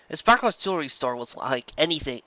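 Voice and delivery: ordinary delivery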